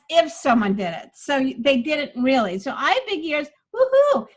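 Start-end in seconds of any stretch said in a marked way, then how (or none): 2.8-4.3 loud defiant comeback, woohoo pitched high; defensive attitude. Shown as a demonstration. Coach demos the joke defensively, loud. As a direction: hit back with attitude. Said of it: "but defensive".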